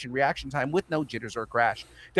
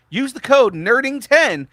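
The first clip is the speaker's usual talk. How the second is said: ad read; 11 semitones higher, 9 dB louder, slower than usual. Acted. Sponsor code read.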